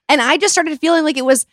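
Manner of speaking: ordinary talk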